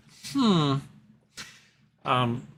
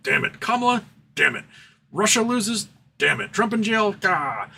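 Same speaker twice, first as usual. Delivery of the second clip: mock-exasperated billionaire, pitch jumping up on each question; lightly hammed-up groan at every bit of bad news; teasing impression of a sore loser; frustrated Ken Griffin; 8 semitones higher than usual. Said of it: "He'd be like".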